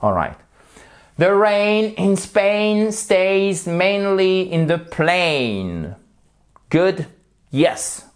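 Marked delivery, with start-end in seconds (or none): 1.2-6.0 "The rain in Spain stays mainly in the plain" said slowly and higher; slow drill. Shown as a demonstration. Teacher models the line again for students, slow. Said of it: "À vous. Allez-y".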